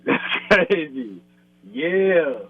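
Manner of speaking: laughing it out slowly. Real, not acted. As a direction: chuckle through the words in disbelief. Surprised by an old question.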